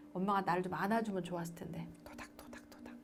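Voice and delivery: soft unvoiced whisper, wistful. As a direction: breathe it, imagining mum's hug. Wishing mother's comforting hug.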